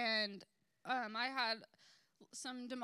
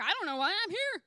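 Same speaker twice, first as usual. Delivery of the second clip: higher and louder, gushing; excited; 8 semitones higher, 6 dB louder than usual. Retold or imitated. Flamboyant man bursts into the booth, excited and loud. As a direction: burst out puzzled and thrilled.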